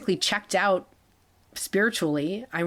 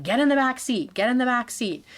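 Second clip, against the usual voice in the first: higher-pitched, insistent mimicked order; retold urgent command; 6 semitones higher than usual. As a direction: repeat it insistently, imitating an attacker's order. Retold attacker ordering her into back.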